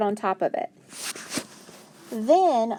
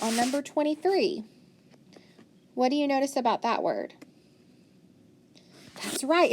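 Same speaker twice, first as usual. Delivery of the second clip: normal talking voice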